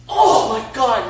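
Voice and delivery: slow, strained exclamation while hoisting a dog; straining to lift. Real, not acted. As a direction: groan it out under a heavy load. Hoisting the heavy dog for squats.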